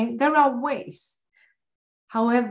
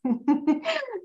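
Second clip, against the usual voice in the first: laugh; 9 semitones higher, 3 dB quieter than usual. Real, not acted. Laughing at own story.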